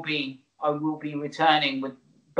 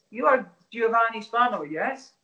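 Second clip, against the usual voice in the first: a badly faked Polish accent, pitched higher; 7 semitones higher than usual. His boss asking his name, in a weak mock Polish accent. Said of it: "I was supposed to be Polish".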